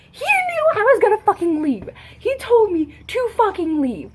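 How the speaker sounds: high, loud, indignant protest; exasperated outburst. Real, not acted. Sister venting about brother blocking the door, exasperated shout. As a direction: let real frustration burst out at full voice.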